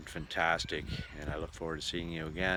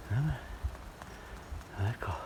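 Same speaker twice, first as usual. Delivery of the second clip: whispered coaxing; gentle whisper; 5 dB quieter than usual. Real, not acted. Whispering to a hitchhiking bug to let go. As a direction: breathe it out softly to the little bug.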